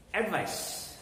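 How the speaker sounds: ADVICE stressed as AD-vice, falling after; first-syllable stress. Shown as a demonstration. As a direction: punch the start of ADVICE. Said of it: "the stress is on the first syllable".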